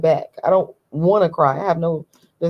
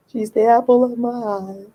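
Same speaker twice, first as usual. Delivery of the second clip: doting; 4 semitones higher than usual. Real, not acted. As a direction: coo it fondly, stretching the words. Dotes on her daughter, repeats it.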